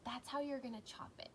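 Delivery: ordinary talk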